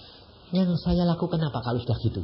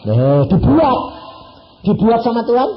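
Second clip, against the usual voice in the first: louder, punchy declaration; emphatic exclamation; 8 semitones higher, 8 dB louder than usual. Real, not acted. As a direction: hit it hard for the crowd. Preacher exclaims loudly. (seruan keras dan tegas)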